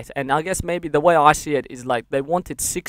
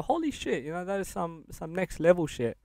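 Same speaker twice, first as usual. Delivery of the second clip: higher, softer, half-breathed disbelief; stunned realisation; 4 semitones higher, 8 dB quieter than usual. Retold or imitated. Looking back on his childhood, hushed high astonishment. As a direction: let it dawn on you, quietly amazed. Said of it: "then I go".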